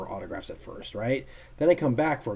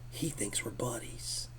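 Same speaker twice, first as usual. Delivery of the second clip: slow, breathless whisper; whispered aside; 3 semitones lower, 13 dB quieter, slower than usual. Real, not acted. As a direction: whisper it in disbelief. Astonished aside about Neil.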